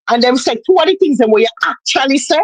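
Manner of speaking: normal talking voice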